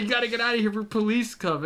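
high, urgent panicked voice; panicked pleading. Retold or imitated. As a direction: beg him to go before police. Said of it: "He's like".

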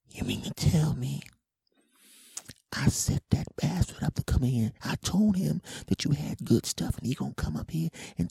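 high, whispery mimicked threat; menacing changed woman's voice. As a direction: whisper threats in a put-on female voice. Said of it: "her voice went from this to this".

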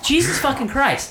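high-pitched, fed-up swearing; exasperated curse. Retold or imitated. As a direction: curse in retold disbelief. Retold quarrel, exasperated curse.

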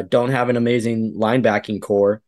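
usual speaking voice

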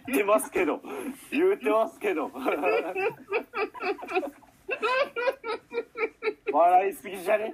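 high, laughing through the words; giggling, can barely get it out; trying not to laugh. Real, not acted. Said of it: "壺に入っちゃったじゃん".

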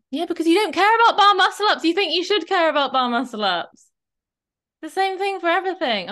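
high, loud, fast impassioned point; rising to a heated rant; passionate outburst. Real, not acted. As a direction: drive the point home with fire. Passionate rant about caring.

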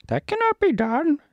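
very high squeaky monkey voice; Monkey King, stern. Acted. Monkey King answers Dorothy's summons, high squeaky royal voice. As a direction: squeal it out as a cartoon monkey ruler.

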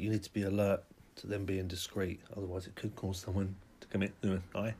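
usual speaking voice

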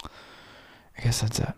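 whisper. Real, not acted. Slow whispered trailing off.

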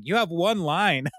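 high, slow mock exasperated reminder; mock exasperation. Tom correcting Leslie's needless backstory, mock exasperated. Exasperated actor scolded in retelling.

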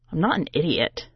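ordinary delivery

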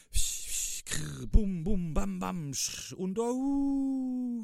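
hisses, crackle and high bangs, ending in a howl; imitated monster noises. A narrator's reading. Piglet and owl showing duck the monster's noise, imitating it. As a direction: mimic scary noises, rising to a long howl. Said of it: "Weil es so macht".

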